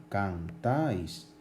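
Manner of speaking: "Cantáis" slowly in two beats, can-táis; diphthong in one syllable. Shown as a demonstration. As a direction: keep the ái of "Cantáis" together in one drawn-out syllable. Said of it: "están dentro de la misma sílaba".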